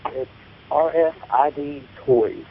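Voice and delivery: usual speaking voice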